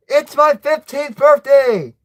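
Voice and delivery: high, loud birthday yell; excited yell. A narrator's reading. Boy suddenly remembering his birthday, yelling. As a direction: shout it out, pitched right up. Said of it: "You remember and yell".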